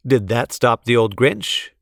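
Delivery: ordinary delivery, nothing marked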